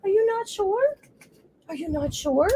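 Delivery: high questioning coo to a dog; pet-talk. Real, not acted.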